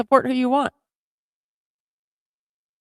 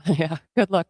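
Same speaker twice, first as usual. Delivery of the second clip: ordinary talk, nothing marked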